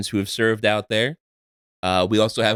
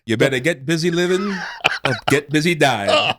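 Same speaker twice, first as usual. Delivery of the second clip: Morgan Freeman impression; 5 semitones higher than usual. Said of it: "Morgan Freeman?".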